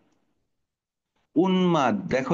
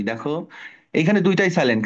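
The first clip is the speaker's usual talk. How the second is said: usual speaking voice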